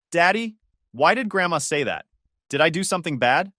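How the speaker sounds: ordinary delivery, nothing marked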